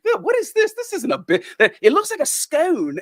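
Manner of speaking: high, incredulous mock schoolboy voice; high-pitched boyish disbelief, put on; puzzled British schoolboy. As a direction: imitate a baffled British kid. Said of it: "middle school British boys".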